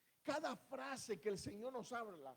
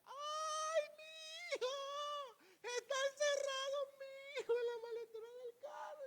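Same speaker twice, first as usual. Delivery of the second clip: shrill panicked shriek, much higher; frantic scream. Acted. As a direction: scream it like a mother who has lost her son. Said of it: "y comienza a gritar". (grito de madre desesperada, mucho más agudo)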